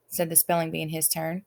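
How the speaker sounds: plain reading voice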